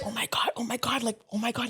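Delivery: hushed, stunned whispering; imitating amazed friends under the breath; awed whisper. Friends' stunned reaction acted out.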